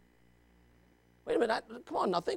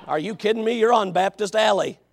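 ordinary delivery

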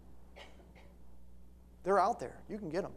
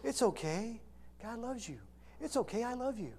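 soft, higher-pitched soothing preacher voice; Joel Osteen; 4 semitones higher, 9 dB quieter than usual. Retold or imitated. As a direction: coo it gently, mocking a soothing preacher. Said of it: "like Joel Osteen preaches".

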